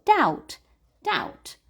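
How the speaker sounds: "doubt" said "dout" with no B; silent B. Shown as a demonstration. Teacher repeating the correct "doubt" for learners. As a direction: say "doubt" clean, no B at all. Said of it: "We don't say the B".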